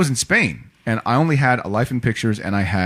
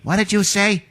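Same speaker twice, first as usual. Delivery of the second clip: much higher, mock scrappy tough kid; hot-tempered kid; 11 semitones higher than usual. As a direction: snap back at the bully, high and cocky. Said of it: "Jack Kirby ... he's like".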